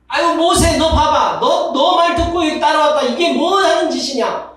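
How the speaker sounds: high, loud indignant complaining; grumbling Israelites. Retold or imitated. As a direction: accuse Moses in a shrill-pitched grumble.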